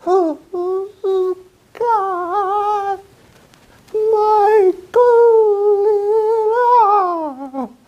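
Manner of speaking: very high, drawn-out sung ghostly wail. Acted. Ghost leaning over the bed into his ear, high drawn wail.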